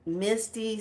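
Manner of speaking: ordinary talk, nothing marked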